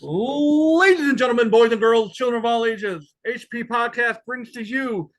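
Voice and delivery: booming ring announcer intro; grand entrance announcement. Acted. Podcast intro hype announcement.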